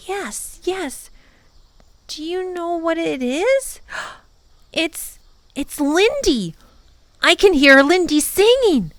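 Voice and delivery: high, breathless rising excitement; boy realizing he hears his lost sister; excited boy. A narrator's reading. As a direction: build from hushed to thrilled.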